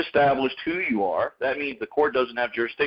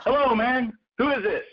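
higher, easygoing hello; friendly phone greeting; 10 semitones higher than usual. Retold or imitated. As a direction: pick up the phone like it's a pal calling. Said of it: "You say".

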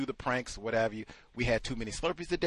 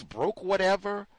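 ordinary talk, nothing marked

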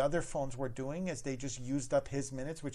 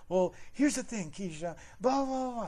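mock customer; 8 semitones higher, 4 dB louder than usual. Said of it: "caller answering manager".

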